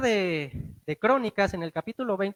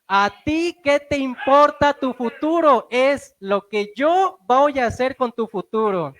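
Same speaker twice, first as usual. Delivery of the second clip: slow, loud and higher, forceful; voicing Jesus; 4 semitones higher, 7 dB louder, slower than usual. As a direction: proclaim it like the risen Lord, pressing each word. (voz de Jesús lenta, alta y fuerte)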